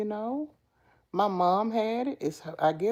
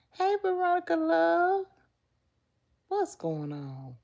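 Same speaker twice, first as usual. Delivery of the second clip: sing-song greeting; 11 semitones higher than usual. Real, not acted.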